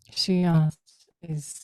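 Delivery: ordinary talk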